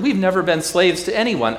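high, loud offended protest; indignant boast. Acted. Preacher voicing proud crowd, high and loud. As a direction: shout it back as the proud crowd.

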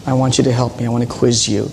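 ordinary talk

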